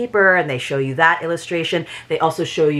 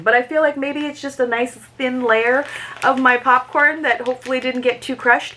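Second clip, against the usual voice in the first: higher, cartoonish squeak; playful squeak over the popcorn; squeaky high voice; 6 semitones higher than usual. Real, not acted. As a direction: keep the silly squeak going. Continues squeaky bit.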